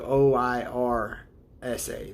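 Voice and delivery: slow, spelling out O I R S A; letters spelled out slowly; sounding out letters. Shown as a demonstration. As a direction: read the letters one at a time, slowly. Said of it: "break it down into smaller segments".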